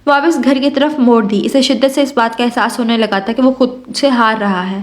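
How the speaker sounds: plain reading voice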